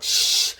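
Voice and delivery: imitated spray "shh"; pepper spray hiss. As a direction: make a sharp, unvoiced spraying noise.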